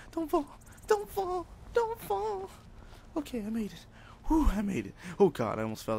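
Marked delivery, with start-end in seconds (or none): 0.1-2.5 very high falsetto pleading; playfully terrified squeal; squeaky panic. Real, not acted. Teetering on edge in game. 3.2-4.9 squeaky sigh of relief; high relieved voice. Real, not acted. Relief after near fall.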